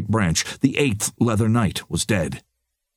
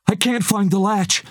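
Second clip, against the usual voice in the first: wailing; 13 semitones higher than usual. A narrator's reading. Lex panics at the wall after the roar, a high wail. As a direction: cry out in alarm. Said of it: "Lex wailed".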